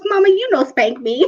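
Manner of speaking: lightly imitated sassy little boy; child with attitude. Three-year-old son defying mom's spanking threat, with attitude. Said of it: "did he say it with attitude".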